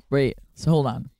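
normal talking voice

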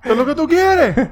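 squeaky quoting of a meme line; mock meme voice.

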